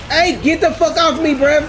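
loud high panicked yell; shouting. Real, not acted. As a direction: scream to shake it off. Attacked in game.

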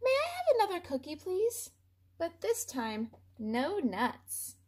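a tiny polite duckling asking nicely; sweet duckling voice. Acted. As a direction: chirp the request politely. Duckling asks politely again.

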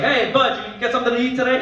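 high, loud offhand dad; dad barely checking on the third kid, high and loud; casual third-kid parenting. Retold or imitated. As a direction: toss it off carelessly. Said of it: "it's just like".

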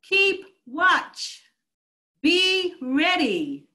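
urgent command from the pulpit; emphatic command. Retold or imitated. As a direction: keep watch punched high and loud, be ready slow and loud. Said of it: "Jesus said very emphatically".

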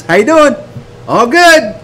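high-pitched breezy exchange; fake cheeriness. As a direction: act out a falsely upbeat greeting. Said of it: "we went up this".

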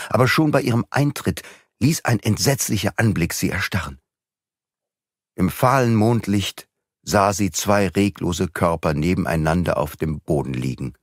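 ordinary delivery, nothing marked

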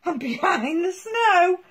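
usual speaking voice

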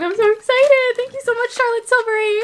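real thrilled thanks; excited. Real, not acted. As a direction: gush at the brand.

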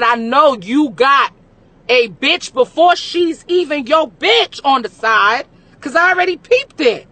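slow, loud, knowing; sassy call-out. Retold or imitated. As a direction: drag it out, calling his bluff. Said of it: "I said".

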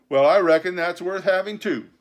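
high, loud pirate delight; joyful cry. A narrator's reading. Dick pleased to keep his Bible, joyful. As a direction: exclaim with relieved glee. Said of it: "cried Dick with a sort of joy".

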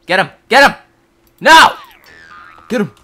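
high, loud yelled commands; urgent battle shouts. Real, not acted. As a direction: yell orders into the fight. Urging on an attack in-game.

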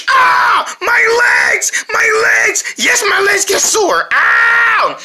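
mock pain. Pretend ad actor complaining about sore legs, hammed-up pain.

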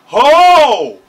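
big high "oh", very loud; amazed shout. Real, not acted. Viewer amazed by her powers, loud whoop of "oh". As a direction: holler an oh in amazement.

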